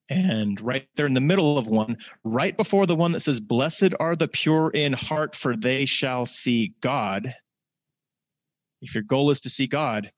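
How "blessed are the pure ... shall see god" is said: slow, louder, solemn reading; scripture reading. Acted. Preacher reading the beatitude to the congregation, slow and solemn. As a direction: dwell on each word.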